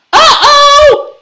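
high, loud little alarm; yelling. A narrator's reading. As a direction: yell out in sudden worry. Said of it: "yelled Gabby".

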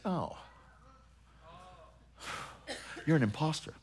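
mocking demon unmasking a fake; quiet contemptuous realization; demon sneer. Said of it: "He goes".

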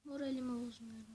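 quiet repeat. Real, not acted. Gamer quietly repeats to himself he stays calm. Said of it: "Şşt".

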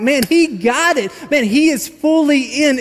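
high, slow, awed exclamation; amazed. Pretend. A reader marvelling at Peter, high and slow.

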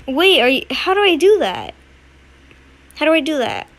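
loud, high panicked question; urgent confusion; frantic. Real, not acted. Confused at hard jump.

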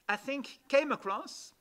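ordinary talk, nothing marked

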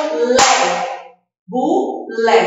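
Bulé split as bu, lé with a gap; two separate syllables. Shown as a demonstration. Teacher counting two syllables, Bulé broken in two. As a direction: say Bulé in two distinct beats. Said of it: "Duas sílabas".

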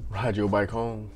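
usual speaking voice

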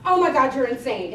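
loud, amused outburst; mock exasperation. Client reacts to his teasing chef, loudly exasperated. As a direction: blurt it out loudly. [name]'s exasperated reply, acted.

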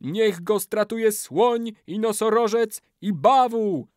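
slow, very high incantation; ritual curse. A narrator's reading. Old man summons beasts against betrayer, high chant. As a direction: wail out the curse.

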